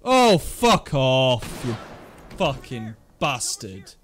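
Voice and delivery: slow, loud drawn-out curse; swearing tirade. Real, not acted. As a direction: spit the curses out slowly. Swearing at enemy in game.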